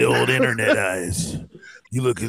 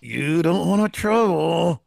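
high, very slow mock threat; ventriloquist dad; 5 semitones higher, much slower than usual. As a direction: stretch it out like a dad playing ventriloquist. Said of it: "turn into a ventriloquist".

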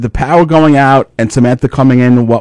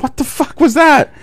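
high, startled waking voice; 14 semitones higher than usual. Retold or imitated. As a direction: jolt awake confused. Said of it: "And I'm like".

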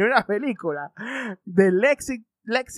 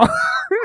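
high laugh; 4 dB louder than usual. Real, not acted. Laughing at own impression.

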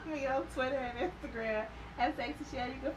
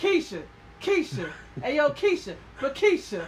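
loud, repeated calls of a name; hammed-up yelling of Keisha; mocking cartoon parody; 3 semitones higher, 6 dB louder than usual. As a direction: parody the show by calling Keisha over and over. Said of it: "that cartoon when they was making fun of Power".

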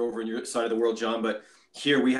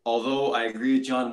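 ordinary delivery

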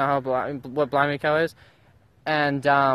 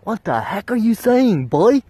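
mock baffled yell; 7 semitones higher, 5 dB louder, slower than usual. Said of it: "and then you're like".

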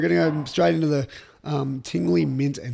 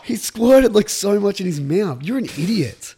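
excited; 5 semitones higher, 7 dB louder than usual. Real, not acted. Reacting to friend's mouthful.

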